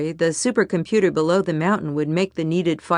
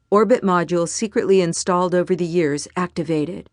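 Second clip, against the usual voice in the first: ordinary delivery, nothing marked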